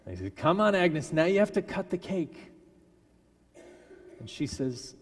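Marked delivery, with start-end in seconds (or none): 0.4-2.5 higher, louder cheerful push; eager urging. Retold or imitated. Cook cheering Agnes on to cut the cake, higher and louder.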